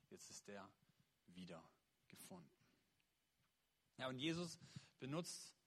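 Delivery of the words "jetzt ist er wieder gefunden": softer and softer toward the last word; the father's closing words, trailing into a hush; fading to quiet. Acted. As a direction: let it die away gently. Father's closing line, fading away.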